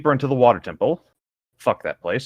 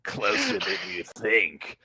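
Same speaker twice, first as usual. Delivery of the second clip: low, slow and soft villain sneer; 3 semitones lower, 8 dB quieter, slower than usual. Acted. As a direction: let the contempt drip out slowly. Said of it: "a sneering voice called".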